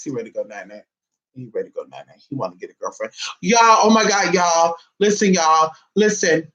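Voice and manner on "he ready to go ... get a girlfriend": soft and low, barely voiced; hushed. Real, not acted. Mother murmuring about her sleepy son, hushed. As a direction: hush it so you don't wake him.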